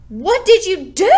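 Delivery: high, loud, outraged cry; yelled. A narrator's reading. Peter yelling at Fudge over the ruined poster, loud high outrage. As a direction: yell it, shocked and furious. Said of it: "I yelled".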